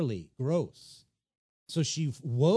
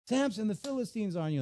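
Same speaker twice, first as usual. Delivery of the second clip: high-pitched mock alarm; Delilah; 8 semitones higher than usual. Delilah waking Samson to a false threat, high mock alarm. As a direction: wake him with a put-on urgent cry.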